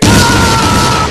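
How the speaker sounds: loud cartoon scream of panic; a big hammed-up scream; mock scream. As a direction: yell it out as the ship gets hit. Ship hit, scream.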